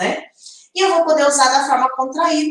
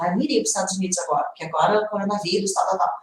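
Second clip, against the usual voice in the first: rushed casual excuse; 3 semitones lower, 5 dB quieter, much faster than usual. Retold or imitated. Acted casual excuse example.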